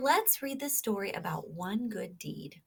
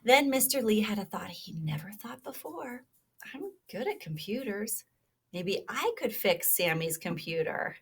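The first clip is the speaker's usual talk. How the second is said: lively storybook read-aloud; reading to children; 2 semitones higher, 8 dB louder than usual. Acted. Teacher reading a kindness story to children, animated. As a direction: read it like a picture book to little listeners.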